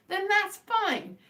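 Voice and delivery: drawn out high and slow, genuinely accepting; sincere acceptance. Real, not acted. Telling viewers their taste is fine, slow and sincere. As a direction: let it go graciously, stretched out. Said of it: "that sounded very sarcastic".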